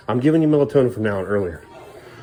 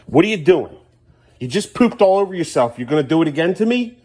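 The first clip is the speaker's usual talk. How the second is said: louder, higher, fed-up dad at one in the morning; real frustrated telling-off, pitched up; exasperated scolding; 8 semitones higher, 5 dB louder than usual. Real, not acted. Dad scolds son pulling pull-up.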